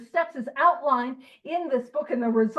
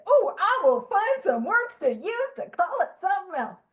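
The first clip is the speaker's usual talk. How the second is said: mock scheming voice; 6 semitones higher than usual. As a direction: play a sly sponsee hunting for euphemisms. Mocking sponsee wordsmithing.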